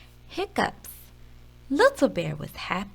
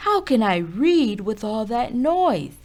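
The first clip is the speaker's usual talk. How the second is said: loud, slow grumbling complaint; Father Bear complains about the noise; annoyed loud; 3 semitones higher, 7 dB louder than usual. A narrator's reading. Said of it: "Father Bear roared".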